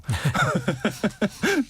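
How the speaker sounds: high, rising run of giggles; embarrassed laugh. Real, not acted. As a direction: laugh at your own tongue slip. Laughs at own pronunciation slip.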